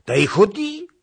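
witch's shout. A narrator's reading. Witch shouting at the Cossack. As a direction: fling it out like a cackling witch. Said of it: "zawołała wiedźma".

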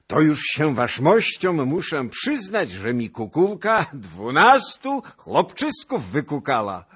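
high, loud, laughing brag; merry laughing boast. A narrator's reading. Pan Jan boasting of twelve sons, laughing loudly. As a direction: chuckle through the boast. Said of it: "wołał rozochocony pan Jan".

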